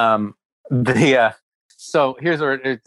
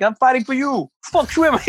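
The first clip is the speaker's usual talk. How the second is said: much higher, fired-up battle cry; mock rallying cry; 11 semitones higher than usual. Barista rallying fans against a celebrity, mock rallying cry. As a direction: shout it like leading a crusade.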